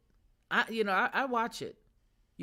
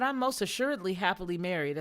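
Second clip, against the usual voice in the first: retold firm, loud declaration; loud but respectful; 2 semitones higher, 5 dB louder than usual. Wife rebuffing an admirer publicly, loud but respectful. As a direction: project every word without turning rude. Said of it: "as loud as I could, but as respectful".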